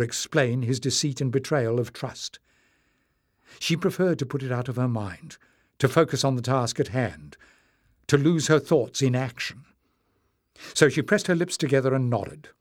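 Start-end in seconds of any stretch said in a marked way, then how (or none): none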